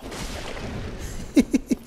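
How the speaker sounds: breathy high-pitched giggle. Real, not acted. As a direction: giggle with delight. Laughs after the attack.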